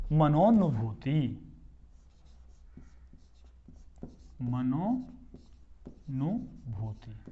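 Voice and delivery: मनोनुभूति stretched out syllable by syllable; slow drawn-out drill. Shown as a demonstration. Teacher spells out the word for students. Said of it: "teacher drills the joined word".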